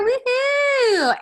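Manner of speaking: high, squealing cheer; excited woohoo. Real, not acted. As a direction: cheer like you just won something. Cheering about the nuts.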